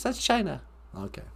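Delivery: ordinary talk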